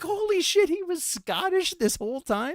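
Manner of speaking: mock high-pitched astonishment; amazed disbelief. Girlfriend realising he was Scottish all along, amazed. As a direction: imitate someone floored by a revelation.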